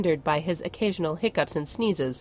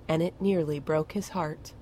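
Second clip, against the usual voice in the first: plain reading voice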